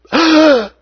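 sharp high gasp of wonder; awed gasp. Shown as a demonstration. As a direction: gasp as if seeing a miracle. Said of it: "Gasping in awe".

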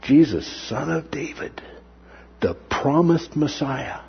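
whispered, awed; hushed awe. Real, not acted. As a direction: breathe it out in wonder. Awed aside about the promised Messiah.

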